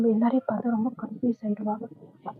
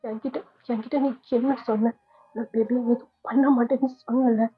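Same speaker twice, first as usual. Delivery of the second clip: angry; 3 semitones higher than usual. Acted. Sister confronts Vaishu tearfully.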